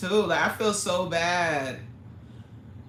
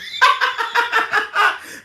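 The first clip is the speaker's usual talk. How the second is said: loud high laugh; 9 dB louder than usual. Real, not acted. Laughs at own mispronunciation.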